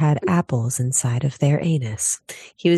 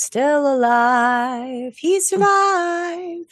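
dramatic high voice; 10 semitones higher, much slower than usual. Pretend. Dramatic hopeful announcement.

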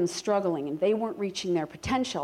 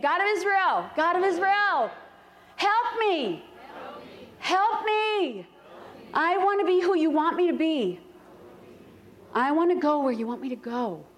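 fervent prayer; 9 semitones higher than usual. Shown as a demonstration. Pastor urging the congregation to pray in earnest, fervent. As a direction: cry out to God like you mean it. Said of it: "Say it like you mean it".

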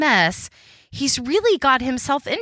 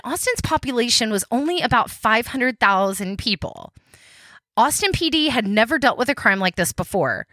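usual speaking voice

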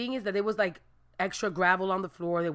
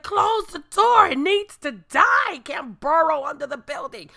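angry orders; 7 semitones higher, 7 dB louder than usual. Retold or imitated. Ordering door shut on rat.

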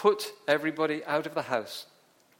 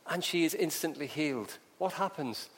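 ordinary talk

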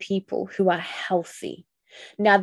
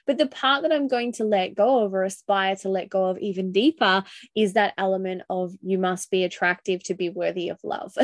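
normal talking voice

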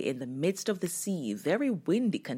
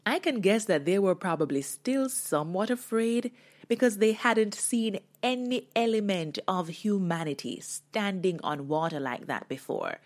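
ordinary talk, nothing marked

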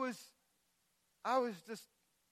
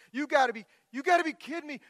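higher, louder, indignant "You gotta be kidding me"; preacher voicing his anger at the betrayers, higher and louder; angry outcry; 6 semitones higher, 8 dB louder, faster than usual. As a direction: hurl "gotta" at them in outrage. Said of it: "I was getting mad".

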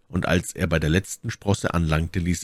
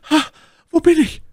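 very high, loud lady's exclamation; crying out; 7 dB louder than usual. A narrator's reading. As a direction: come to with a startled cry. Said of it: "stieß einen Seufzer aus und rief dann". (erschrockener, hoher Ausruf)

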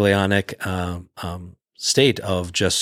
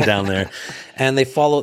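ordinary talk

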